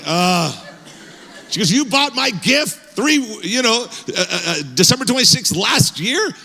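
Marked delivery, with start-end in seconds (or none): 1.7-6.3 incredulous wife. Retold or imitated. Wife incredulous about early gift.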